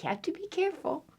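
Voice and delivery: ordinary delivery, nothing marked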